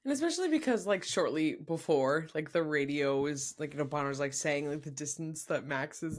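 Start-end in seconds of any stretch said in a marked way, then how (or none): none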